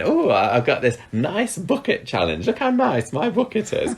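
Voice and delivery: high, dithering Mavis voice; squeaky, fussing Mavis; Mavis impression. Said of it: "He was dressed up as Mavis".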